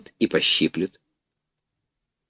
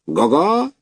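higher honking call; hearty goose cry; 7 semitones higher than usual. A narrator's reading. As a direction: call the flock like a gander. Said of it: "закричал гусак".

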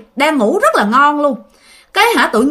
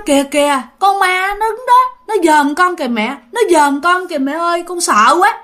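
put-on higher child's voice, pleading and scared; frightened child; 5 semitones higher than usual. Child points at the hanging shirt, begging mother, scared. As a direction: imitate a terrified kid pointing at the ghost. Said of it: "sợ hãi dữ lắm mà nó khóc".

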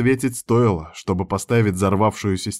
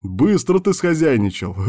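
higher, teasing delivery; chortling; 7 semitones higher than usual. A narrator's reading. Friend joking about how fast it happened, teasing. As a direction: tease him, on the edge of laughter. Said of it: "хохотнул Кир".

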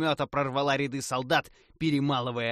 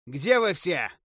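calling out; 6 semitones higher, 8 dB louder, slower than usual. A narrator's reading. Calling out for allies.

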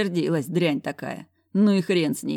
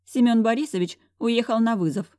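higher, dismissive secretary; 7 semitones higher, 3 dB louder than usual. A narrator's reading. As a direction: voice a sniffy receptionist. Secretary replies to hero.

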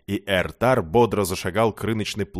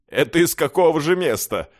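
higher-pitched mocking question; guffawing mockery; 6 semitones higher, 4 dB louder than usual. A narrator's reading. Beggars jeer at the old man's tall tale, mockingly. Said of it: "загоготали нищие".

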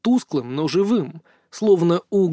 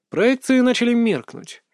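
plain reading voice

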